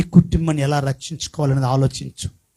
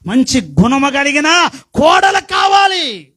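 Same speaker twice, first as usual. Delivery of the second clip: high, loud sermon cadence; fiery preaching; 5 semitones higher, 3 dB louder than usual. Acted. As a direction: preach it out to the congregation. Preaching at full force.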